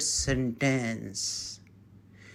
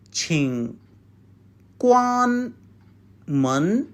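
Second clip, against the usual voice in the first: syllable by syllable; slower than usual. Shown as a demonstration. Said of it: "Please close the door".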